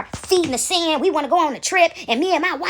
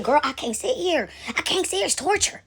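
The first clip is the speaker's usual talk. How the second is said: hushed protest; 5 dB quieter than usual. Retold or imitated. Churchgoer telling her friend she can't stay, hushed protest. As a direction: protest quietly, voice rising as it goes.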